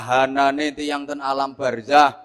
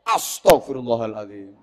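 card-slamming pious exclamation; 5 semitones lower than usual. Acted. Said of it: "banting karo maca selawat".

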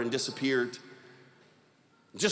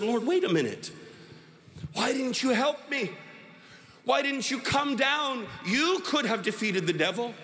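acted indignant appeal, pitched up; pleading reproach; 6 semitones higher than usual. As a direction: protest to the Lord, stretching the questions. Man reproaches Jesus for not helping.

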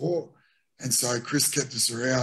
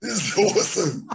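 excited disbelief; 8 semitones higher, 3 dB louder than usual. Retold or imitated. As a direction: gush like a thrilled rookie who doesn't get it. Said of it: "I go".

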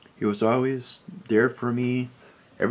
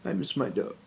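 quiet, choked-up grief, much softer; barely holding back tears; hushed heartbroken confession; grieving; 5 semitones lower, 8 dB quieter than usual. Real, not acted. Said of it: "I'm kind of emotional".

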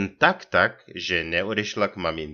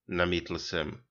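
plain reading voice

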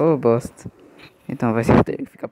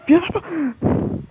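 high, slow strangled curse; frustrated cry; much slower than usual. Real, not acted. Cut-off curse at game failure.

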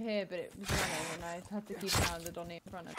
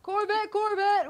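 scared horror game streamer; 11 semitones higher, 7 dB louder, slower than usual. Real, not acted. Calling a friend in game.

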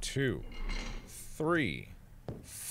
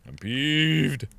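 peeved. Real, not acted. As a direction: draw out PEEVED in a raised voice. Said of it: "I'm peeved, guys".

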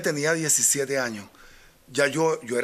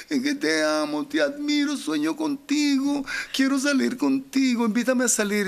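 put-on high, breathy-free girlish plea, much higher; girl's voice; 9 semitones higher than usual. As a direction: play the teen fan swooning over him. Girl's fan confession retold.

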